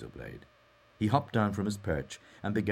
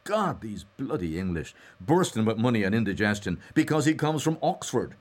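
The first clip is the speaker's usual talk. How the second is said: raised, emphatic scorn; frank complaint; 7 semitones higher, 6 dB louder than usual. A narrator's reading. Mulligan runs down Haines to Stephen, frank scorn. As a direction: rail frankly, mostly high and loud. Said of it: "he said frankly".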